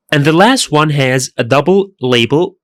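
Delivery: ordinary talk, nothing marked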